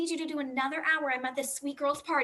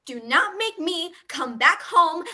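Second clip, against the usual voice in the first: slow, louder warning down the phone; angry mom threat; 2 semitones higher, 6 dB louder, slower than usual. Acted. Mom at a party threatens Jimmy over the phone, slow and louder. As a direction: threaten the kid, word by word.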